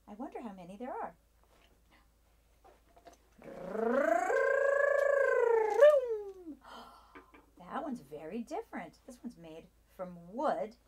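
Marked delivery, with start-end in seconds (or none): 3.4-7.4 high loud delighted gasp; big gasp. Acted. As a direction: gasp in wonder. Surprise at new car.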